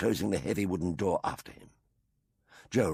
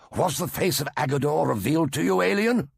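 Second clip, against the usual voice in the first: higher, louder accusing cry; cried; 7 semitones higher, 5 dB louder than usual. A narrator's reading. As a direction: cry it out as a charge. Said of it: "cried Hepesh".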